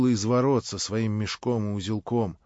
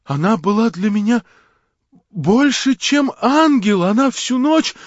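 высоко, громко, тянет «ангел»; захлёбывайся от восхищения; восторженный порыв; 11 semitones higher, 9 dB louder than usual. Acted. Степан Трофимович оправдывается в экстазе. (восторженное признание)

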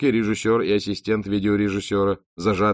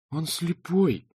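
a higher, lightly raised cry; a half-raised protest; mild shout; 10 semitones higher, slower than usual. Acted. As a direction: call out in his defence. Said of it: "крикнула Милисент".

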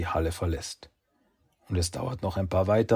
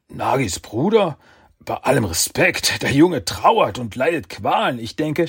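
anxious pleading; 6 semitones higher, 7 dB louder than usual. Acted.

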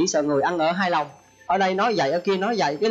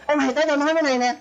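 higher, fast, brash calling a friend over; rude call-out; 8 semitones higher, faster than usual. Acted. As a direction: holler at a buddy carelessly. Gọi bạn kiểu mày tao. (giọng gọi bạn cao, nhanh, xấc xược)

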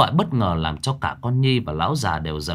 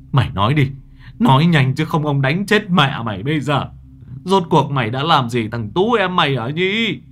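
loud, higher furious interrogation; angry demand; 5 semitones higher, 5 dB louder than usual. A narrator's reading. Grandfather yells at Nhi demanding what happened, furious. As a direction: demand the truth with rage. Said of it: "ông vừa vả ông vừa chửi".

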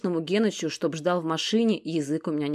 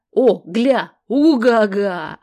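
loud, slow whooping Uga-ga; excited whoop; 5 semitones higher, 10 dB louder, much slower than usual. A narrator's reading. Genych spotting graffiti on the fence, whooping. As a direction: hoot at the fence like a kid.

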